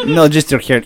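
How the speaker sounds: ordinary talk, nothing marked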